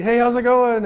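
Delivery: high, loud, breezy hello; passing street greeting. Acted. Greeting passers-by without caring.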